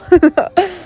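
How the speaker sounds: quick real burst of laughter; a brief rising giggle; short laugh. Real, not acted. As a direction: laugh out at the absurdity. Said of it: "sorry, I'm, like, laughing".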